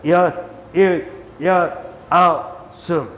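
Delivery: slow, punched count with gaps; exercise count. Real, not acted. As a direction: call each number separately, driving the movement. Counts out leg movements. (한 박씩 끊어 세는 운동 구령)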